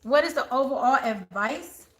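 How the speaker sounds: ordinary talk, nothing marked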